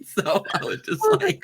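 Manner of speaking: high, soft, laughing through the words. Real, not acted. Reader laughs about the insult he held back, giggling.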